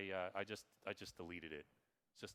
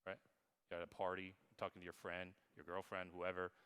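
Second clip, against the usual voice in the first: usual speaking voice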